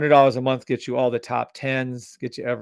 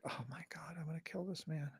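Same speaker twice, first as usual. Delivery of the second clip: whispered, much softer, seething; exasperated whisper; 3 semitones higher, 23 dB quieter than usual. Real, not acted. Fed-up streamer mutters about a clueless viewer, whispered. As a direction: hiss it under your breath in disbelief.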